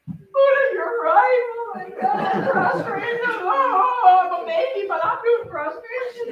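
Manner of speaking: mock wailing baby voice; hammed-up crybaby giving in; half-laughing mock sob of a baby; crying baby. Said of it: "he cried and he was like".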